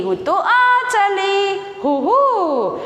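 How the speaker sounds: high, sing-song joyful whoop; crying out aloud. Acted. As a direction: call it out like a thrilled rider. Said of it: "અને બોલી ઉઠી".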